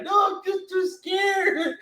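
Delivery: high, slow mock-frightened cry; squeaky hammed-up scared wail. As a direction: wail it like a terrified kid. Finn again, scared.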